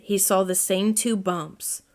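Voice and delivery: ordinary delivery, nothing marked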